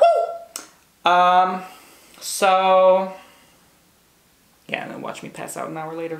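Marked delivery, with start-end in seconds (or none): 0.0-1.1 squealing hyper woo; excited whoop. Real, not acted. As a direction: let out a giddy hyper cheer. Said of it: "My blood sugar went up".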